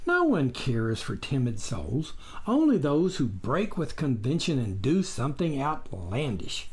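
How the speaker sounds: ordinary delivery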